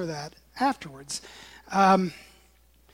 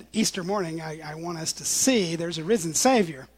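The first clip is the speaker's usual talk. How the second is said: ordinary delivery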